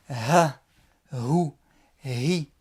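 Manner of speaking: Arabic ha demo; breathy throat H. Shown as a demonstration. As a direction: push air out like you're out of breath. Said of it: "Ha, comme lorsqu'on est essoufflé".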